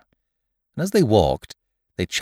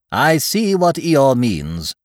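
high, slow, loud owl voice; self-assured storybook owl, drawn out; Owl, pronouncing; 5 semitones higher, 5 dB louder, slower than usual. Acted. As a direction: pronounce it slowly and loudly, pitched up.

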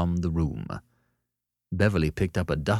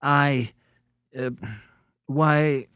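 halting, higher-pitched confusion; somewhat confused; 9 semitones higher, 4 dB louder, much slower than usual. A narrator's reading. Beverly is asked about work he doesn't know, confused. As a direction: stammer with gaps between the words. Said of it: "Beverly seemed somewhat confused".

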